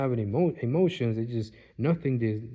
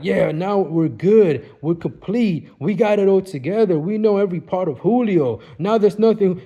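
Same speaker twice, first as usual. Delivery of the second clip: gloating emphasis; 6 semitones higher, 9 dB louder than usual. Retold or imitated. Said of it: "that emphasis on".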